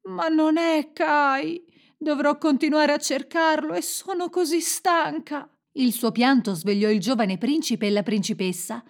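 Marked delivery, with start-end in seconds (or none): 0.0-5.5 weary, high-pitched despair; tearful. A narrator's reading. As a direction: wail it wearily, near tears. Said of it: "scoppiò in lacrime".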